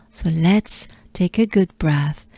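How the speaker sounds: normal talking voice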